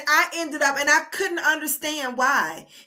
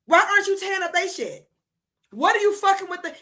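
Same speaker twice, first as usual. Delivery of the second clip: higher, incredulous demand; raised, disbelieving rant; exasperated outburst; 6 semitones higher than usual. Real, not acted. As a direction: throw the question at them in disbelief. Indignant rant at Mexicans.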